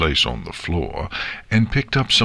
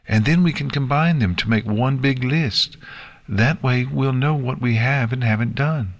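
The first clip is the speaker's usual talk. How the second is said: higher-pitched character voice, making a suggestion; cautious Nix; 6 semitones higher than usual. Acted. Nix suggests making lists to her friends, cautious. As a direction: lift the voice for a tentative pitch to friends.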